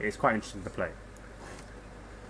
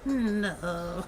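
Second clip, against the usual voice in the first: higher, clipped maid's no; Consuelo maid no; 9 semitones higher, 4 dB quieter than usual. Retold or imitated. Answering a pretend question as the cartoon maid, higher. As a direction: refuse as the maid. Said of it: "Consuelo voice".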